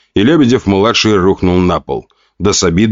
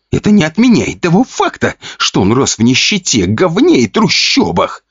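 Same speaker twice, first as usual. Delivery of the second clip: snorting scorn; 9 semitones higher than usual. Acted. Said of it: "фыркнул парень".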